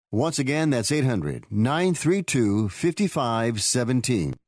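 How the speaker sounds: hard sell ad. Acted. Ad voice urging listeners to call.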